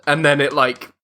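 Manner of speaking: normal talking voice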